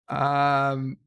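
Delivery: ordinary talk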